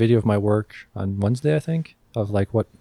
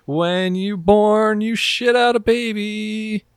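mock grand proclamation; 7 semitones higher, much slower than usual.

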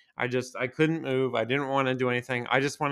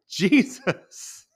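shocked gasp; 7 semitones higher, 5 dB louder than usual. Retold or imitated. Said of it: "she goes".

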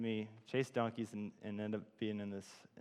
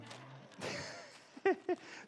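high, breathy laugh. Real, not acted. Speaker wrapping up his talk to the group, laughing. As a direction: laugh out high for a couple of seconds.

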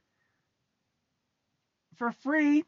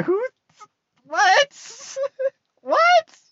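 high, cracking disbelief; incredulous squeal; 3 dB louder, much slower than usual. Real, not acted. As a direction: pitch leaps way up into a squeaky disbelieving what. Incredulous at being disrespected.

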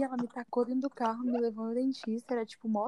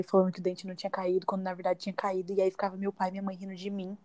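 normal talking voice

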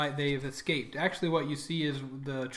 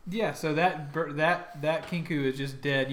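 ordinary talk

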